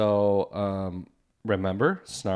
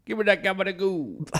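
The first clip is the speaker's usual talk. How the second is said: mock Italian; 8 semitones higher than usual.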